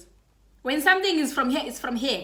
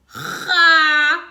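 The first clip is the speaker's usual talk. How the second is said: bursts out laughing, loud and high; a real, loud laugh; loud burst of laughter; 6 semitones higher, 9 dB louder than usual. Real, not acted. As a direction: crack up at the memory. Laughing at her own story.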